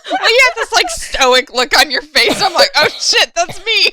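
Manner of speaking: loud, high words through laughter; slap-happy, breaking into laughter; laughing hard. Real, not acted. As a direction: tell it while cracking up. Cracking up over missed cue.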